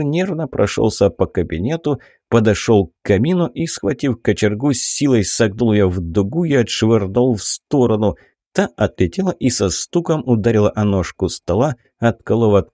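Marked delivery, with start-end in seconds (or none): none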